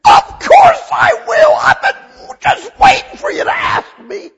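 high, loud, overjoyed cry of welcome; joyful shout. Acted. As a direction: shout it out with delight like a thrilled father. Preacher voicing God's joyful answer.

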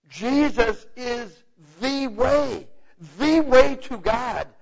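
building preaching. Acted. Preacher to congregation, building to full force. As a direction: build each phrase, climbing with force.

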